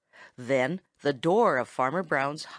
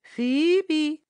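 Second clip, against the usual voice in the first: high, whistled phoebe note; whistled bird reply; 11 semitones higher, 4 dB louder than usual. A narrator's reading. Tommy Tit answers the boy's whistle with a whistle. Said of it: "replied Tommy Tit".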